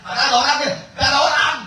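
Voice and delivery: high, loud "nobody's home" yell; mock shout from inside. Person behind the door yells that nobody is home, mock shout. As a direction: bellow it through the door, hammed up.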